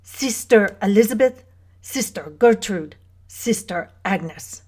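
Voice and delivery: put-on deliberate German-accented roll call; German matriarch listing names, slowing at the end. A narrator's reading. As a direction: present each one firmly in a German accent. German woman names the sisters.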